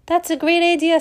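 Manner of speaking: high, loud eager voice; eager show-off. Acted. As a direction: gush like a teacher's pet. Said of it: "who never misses the chance to show off".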